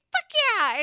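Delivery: high, loud eager shout; excited yell. Retold or imitated. Paramedic answering her partner, an excited loud yes. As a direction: jump at the chance, loud and high.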